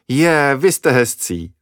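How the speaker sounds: slow, amused little laugh through the words. Acted. Kamila laughs to the children after feeling their faces. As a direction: tease them kindly, laughing. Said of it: "zasmála se Kamila".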